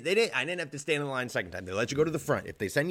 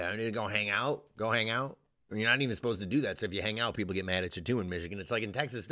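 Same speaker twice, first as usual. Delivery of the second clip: ordinary talk, nothing marked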